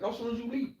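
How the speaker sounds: ordinary talk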